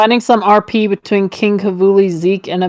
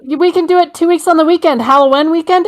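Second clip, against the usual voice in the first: put-on childish girl voice; 7 semitones higher than usual. Mocking a childish post aloud.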